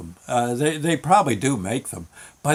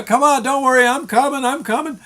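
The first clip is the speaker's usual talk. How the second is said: high, raised calling voice; father calling out; 10 semitones higher than usual. Retold or imitated. Father to lost kid in fog, calling out. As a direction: call reassurance across a distance. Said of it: "I hear my father go".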